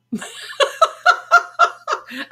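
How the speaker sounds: high, loud staccato laugh; high-pitched mock cartoon cackle.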